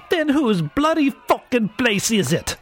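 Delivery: high, strained enraged demand; furious scream. A narrator's reading. Captive screaming into the missionary's face, enraged. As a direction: scream the challenge with pitch right up. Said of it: "Lerwick screamed into my face".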